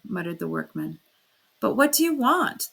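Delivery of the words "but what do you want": angry shout. A narrator's reading. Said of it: "the porter shouted again, beginning to get angry".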